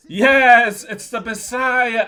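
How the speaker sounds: loud high burst of triumph; excited yes. Real, not acted. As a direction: shout the answer out with a rising thrill. Excited answer to audience.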